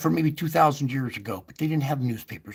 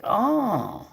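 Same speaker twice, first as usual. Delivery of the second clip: high, delighted oh yeah; light imitated relief; pleased surprise; 8 semitones higher, slower than usual. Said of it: "And you're like".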